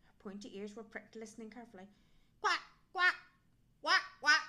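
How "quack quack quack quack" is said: loud, high quacking; punchy duck quacks. A narrator's reading. Duck's reply in story.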